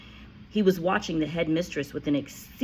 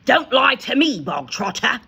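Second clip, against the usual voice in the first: loud, higher bark; 8 semitones higher, 10 dB louder than usual. A narrator's reading. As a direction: snap the order at him. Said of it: "barked the Trunchbull".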